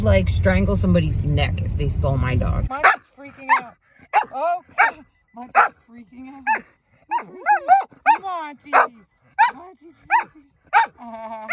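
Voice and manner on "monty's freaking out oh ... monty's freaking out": high sing-song cooing at the dog; high dog-talk voice. Real, not acted. Owner coos high at anxious pug Monty. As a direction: coo high at the excited pug.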